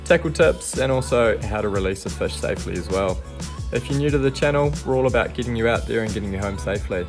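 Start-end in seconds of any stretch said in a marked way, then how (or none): none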